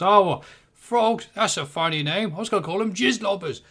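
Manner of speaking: a clumsy go at an Aussie voice from The Simpsons; awful Australian accent. Retold or imitated. Quoting a Simpsons character in a bad Australian accent. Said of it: "sorry for that awful attempt at accent".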